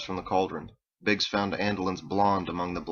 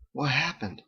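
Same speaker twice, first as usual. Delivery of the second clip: higher, tender question; concerned; 6 semitones higher than usual. A narrator's reading. Biggs embraces injured Lynn, concerned. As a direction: ask with pained concern. Asks about her injury.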